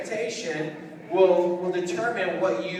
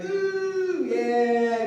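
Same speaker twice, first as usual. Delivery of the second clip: mock excitement; 4 semitones higher than usual. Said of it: "Get excited".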